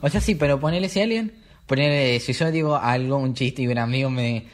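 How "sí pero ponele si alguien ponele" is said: usual speaking voice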